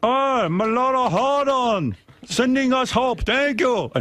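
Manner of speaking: high, slow mock Chilean accent; pleading miner in a hammy Chilean accent. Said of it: "I don't know how to do a Chilean accent".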